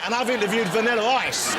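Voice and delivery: laughing through the punchline. Real, not acted. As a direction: laugh while delivering the joke.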